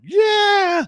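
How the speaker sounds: very high, drawn-out celebratory scream; Papelbon's victory yell. Retold or imitated. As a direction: let out a huge triumphant shout. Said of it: "So Papelbon does the whole".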